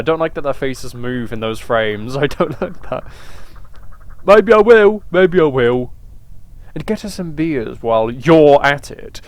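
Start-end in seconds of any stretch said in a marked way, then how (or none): 4.3-5.0 mock bold declaration. Voicing character line then repeating.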